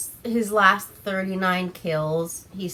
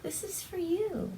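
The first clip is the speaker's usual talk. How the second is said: mock sweet; 10 semitones higher, 10 dB quieter than usual. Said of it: "He's like".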